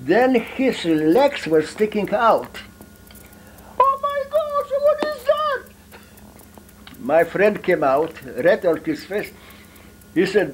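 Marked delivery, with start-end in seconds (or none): 3.8-5.6 put-on squealed very high falsetto old woman; startled grandmother. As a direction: shriek it like a shocked granny. Grandmother discovers hidden friend.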